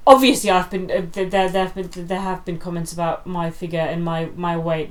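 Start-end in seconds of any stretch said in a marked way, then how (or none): none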